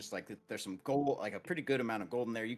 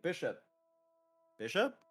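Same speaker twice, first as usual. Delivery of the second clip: calling out; 7 semitones higher, 3 dB louder than usual. Acted. Bren calling for missing man.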